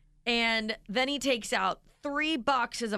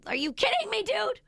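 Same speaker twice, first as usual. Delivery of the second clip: mock outrage; 11 semitones higher than usual. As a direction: let your disbelief soar up to "dude". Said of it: "I'm like".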